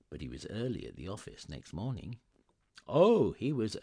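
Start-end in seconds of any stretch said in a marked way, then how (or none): none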